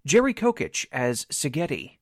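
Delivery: ordinary delivery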